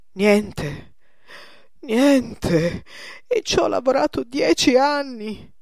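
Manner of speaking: loud, rising cry of despair; ruined painter crying out in loud despair; anguished despair. Acted. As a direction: break down over ten lost years. Painter realises ruin.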